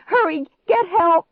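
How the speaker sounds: loud, high-pitched urgent scream; panicked shriek. A narrator's reading. Fleeing girl sends Clark for help, shrieking. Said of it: "I shrieked".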